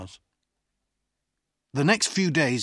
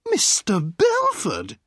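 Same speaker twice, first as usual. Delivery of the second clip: drawn-out delighted hail, raised in pitch; sweet-voiced cry; 5 semitones higher, 6 dB louder, slower than usual. A narrator's reading. Woman calls to the lawyer in a restaurant, crying out sweetly. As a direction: call the name out, slow, high and loud. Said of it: "a sweet voice cried".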